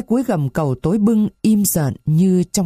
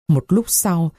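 plain reading voice

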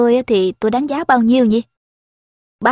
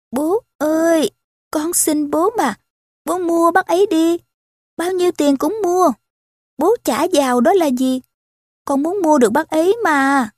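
put-on pleading little girl; 6 semitones higher than usual. A narrator's reading. Eva begging her father to buy the man, pleading high child voice. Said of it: "thì thầm".

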